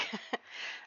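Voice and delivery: short quiet chuckle; soft breathy laugh to oneself. Real, not acted. Amused at character in sunlight.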